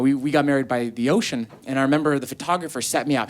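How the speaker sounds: normal talking voice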